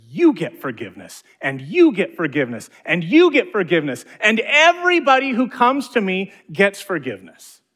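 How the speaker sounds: building from loud to high, ringing shout; joyful proclamation. Acted. Preacher voicing God to the congregation, rising excited proclamation. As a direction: hand it out like a jubilant giveaway. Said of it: "And God looks at us and says".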